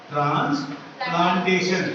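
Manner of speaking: Transplantation drawn out in slow parts; slow term repetition. Shown as a demonstration. Teacher repeats new term slowly. (Transplantation को धीरे-धीरे तोड़कर बोलना)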